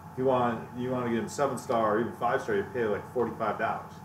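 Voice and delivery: usual speaking voice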